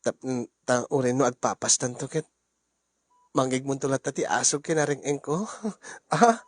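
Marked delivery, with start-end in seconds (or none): 5.8-6.5 laughing. Acted. Laughing at husband.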